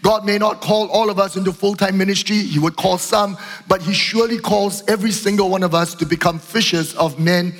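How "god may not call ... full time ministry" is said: normal talking voice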